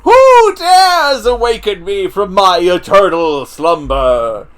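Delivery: slow, loud, booming summoned wizard. Acted. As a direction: boom it out like an ancient wizard waking up. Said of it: "Launching help wizard".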